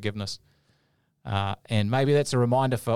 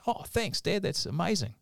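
put-on excited boy; 8 semitones higher than usual. Little boy thanks dad for money, acted high-pitched excitement. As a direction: imitate a smiling, thrilled little boy. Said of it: "he's all excited and he's smiling".

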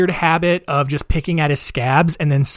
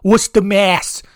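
loud, higher mock-Boston accent; 6 semitones higher, 4 dB louder than usual. Mocking how locals name their town, broad Boston accent. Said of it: "people from Massachusetts always talk like".

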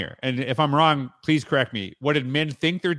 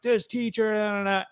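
mock upset parent; 8 semitones higher than usual. Upset parent voiced in story.

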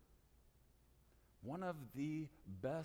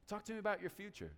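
blunt demand; 6 semitones higher, faster than usual. Shown as a demonstration. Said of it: "And I don't say it like that".

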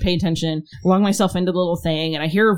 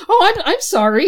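high, louder, stammered apology; flustered apology; 13 semitones higher, 5 dB louder than usual. Retold or imitated. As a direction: blurt an awkward sorry way up high.

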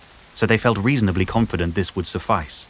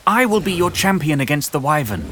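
heroic shout; 9 semitones higher, 4 dB louder than usual. Acted. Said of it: "walked through the village shouting her intentions".

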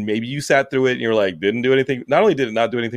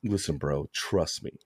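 hushed Kathy Bates menace; 4 semitones lower than usual. Retold or imitated. Friend imitated as a creepy obsessive, murmured and hushed. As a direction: lean in and murmur like an obsessed fan. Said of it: "you're Kathy Bates in Misery".

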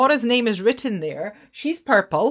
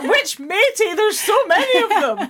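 laughing mock confusion; 12 semitones higher than usual. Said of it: "and I'm like".

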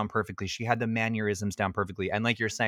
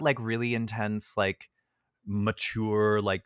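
normal talking voice

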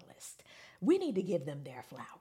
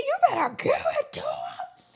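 mock cheer, laughing; 6 dB louder than usual. Fan cheering on a performer, high laughing squeal.

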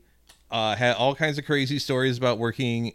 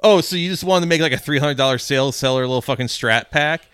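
mocking retort; 3 semitones higher, 8 dB louder than usual. [name] scoffing at coworkers over a cheap guitar pack, loud and scornful. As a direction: sneer at the coworkers' small sales.

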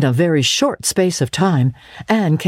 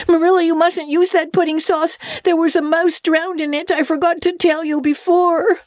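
mock shriek. Girl retelling how she shrieked at Marilla, mock alarm. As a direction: imitate her own frantic shout at dinner. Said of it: "I just stood up in my place and shrieked out".

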